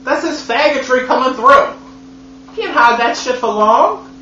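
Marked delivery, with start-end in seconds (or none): 0.0-3.1 indignant. Real, not acted. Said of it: "it looks like diamonds".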